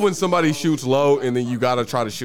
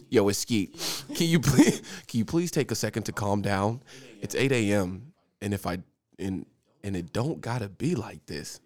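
softer, slightly lower imitation of a texter; Skeet; 4 semitones lower, 6 dB quieter than usual. Reading Skeet's text to calm the rapper down, lightly imitated. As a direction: lightly put on a calm, low-key character. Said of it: "That's how he talks".

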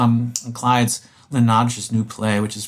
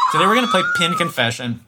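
teacher announcing game; 8 semitones higher, faster than usual. Retold or imitated. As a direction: call it out to the class. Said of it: "I used to do pin confessions".

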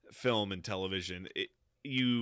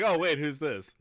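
surprised double take; 6 semitones higher, 6 dB louder than usual. Retold or imitated. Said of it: "And he's like".

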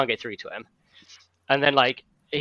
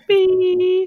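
high, loud imitated ringing tone; piercing held beep; tinnitus beep; 7 dB louder than usual. Shown as a demonstration. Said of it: "You know the tinnitus noise".

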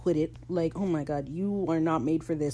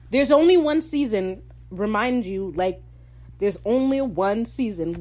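loud, high protest; pitched-up, forceful complaint; exasperated; 3 semitones higher, 5 dB louder than usual. Real, not acted. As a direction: hammer the point home in exasperation. Complaining about needless flashbacks.

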